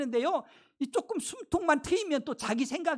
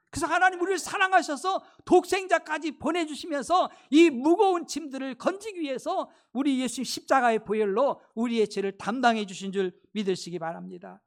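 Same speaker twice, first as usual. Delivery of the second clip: ordinary talk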